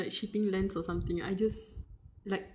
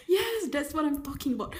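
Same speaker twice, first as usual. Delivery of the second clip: cheering; 7 semitones higher, 6 dB louder than usual. Real, not acted. Cheering the character's line.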